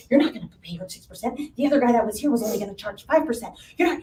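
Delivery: rattled-off fast quote; fast, pushy wife. Retold or imitated. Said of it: "And she's like".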